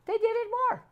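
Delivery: sudden high squeaky exasperation. Retold or imitated. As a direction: jump way up in pitch, mock outrage. Children defy the mom look.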